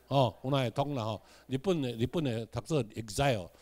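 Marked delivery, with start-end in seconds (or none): none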